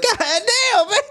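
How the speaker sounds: high-pitched, laughing astonishment; retold awe, voice way up high; amazed reaction. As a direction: exclaim it blown away. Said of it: "I was like".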